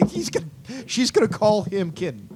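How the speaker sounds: high, giggling delivery; laughing. Real, not acted. Amused by the pet name idea, laughing. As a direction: squeak it out through laughter.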